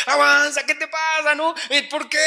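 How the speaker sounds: impatient driver. Retold or imitated. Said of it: "tú vas atrás diciendo".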